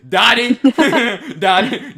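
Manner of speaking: laughing at a name. Real, not acted. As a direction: giggle through the wrong name. Mangling a name while laughing.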